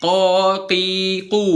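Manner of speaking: "Qaqiqu" sangat pelan, qaf tebal; latihan qaf pelan. Shown as a demonstration. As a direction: eja "Qaqiqu" perlahan. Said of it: "praktek huruf qaf". ("Qaqiqu" qaf tebal pelan)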